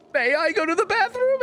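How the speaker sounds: exaggerated high-pitched pupil's correction; mock-proper request. Pupil corrects himself for teacher, hammed-up high mock politeness. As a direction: overdo the corrected question, very high. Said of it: "And I was like".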